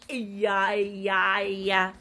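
low mock crying sounds; mock whimper. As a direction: pretend sobbing. Toy sobbing.

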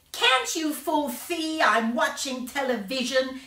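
high, slow angry snap; angrily. A narrator's reading. Boy barking at visitors who interrupt his television, angry. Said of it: "he said angrily".

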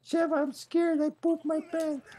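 high, slow whiny scared voice; Chris scared. Acted. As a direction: whimper like a frightened kid. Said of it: "Imagine Chris going that".

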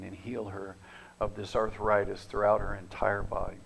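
low, hushed healing prayer; soft prayer. Retold or imitated. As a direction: pray it softly over her.

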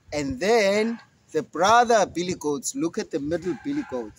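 ordinary delivery

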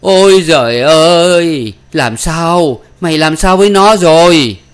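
high, loud, drawn-out cry of shock; scandalized father shouting; aghast bellow. Acted. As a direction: roar in horrified disbelief. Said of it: "miệng lão quát lên".

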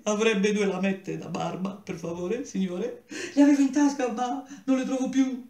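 high, whining plea; pleading young man. Acted. Tearful boy begs a stranger for razor blades. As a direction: beg pitifully, voice thin and high.

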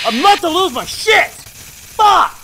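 high, loud raging scream; losing it completely, screaming; furious screaming. Real, not acted. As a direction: scream it out at the game. Gamer raging at game.

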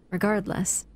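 normal talking voice

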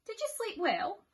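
high, sweet question; high caring wife; sweet Missus Bear. Acted. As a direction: ask kindly. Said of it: "she said".

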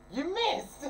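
high laughing jab; laughing taunt. Real, not acted. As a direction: tease through laughter. Mocking mistake with laughter.

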